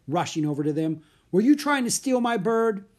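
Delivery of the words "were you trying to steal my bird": high, loud, indignant challenge; angry accusation. Acted. Bird seller confronting two children over his bird, angry. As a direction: accuse them with outrage.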